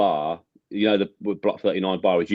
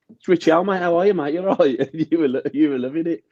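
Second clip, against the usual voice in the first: mock excited hello; 9 semitones higher than usual. S0 acts out greeting Jay.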